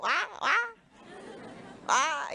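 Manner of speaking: mock Jamaican wah, wah, wah, pitched high; Jamaican speech pattern. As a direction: playfully imitate the Jamaican speech rhythm. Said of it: "And this Jamaican pattern".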